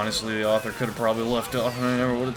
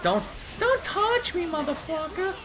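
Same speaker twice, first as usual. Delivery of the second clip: squeaky damsel snapping, much higher; high mock-heroine outburst; high girly heroine yelling. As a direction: shove him off in a high voice. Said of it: "She's like".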